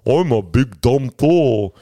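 slow, lightly voiced dumb hero; dumb Thor. Mocking how dumb Thor acts, slow dopey voice. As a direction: play it slow and dim. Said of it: "where he's just like".

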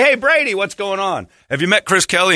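imitating a chipper introduction; mock cheery host. Host introducing Chris to Brady, mock cheery.

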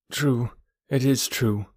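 put-on small, contrite boy voice; sorrowful. A narrator's reading. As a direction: admit it with mild sorrow. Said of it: "said Mowgli sorrowfully".

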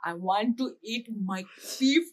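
put-on loud, slow Indian-accented dad; dad's Indian accent. Daughter imitating dad asking for beef curry, Indian accent. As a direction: imitate an Indian dad demanding his dinner. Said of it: "My dad says bloody all the time".

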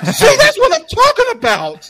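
loud, very high whiny shriek; hammed-up squealing outrage; mock hysterics. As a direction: imitate a hysterically offended kid. Acts out hysterical co-worker.

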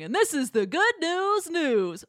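slow announcer voiceover; drawn-out TV host intro; Dad's voiceover. Retold or imitated. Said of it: "Dad's voiceover".

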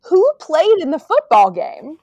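baffled outcry at a plot hole; incredulous. Real, not acted. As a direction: demand to know, pitch swooping high in disbelief.

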